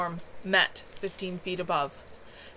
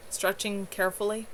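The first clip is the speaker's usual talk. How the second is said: plain reading voice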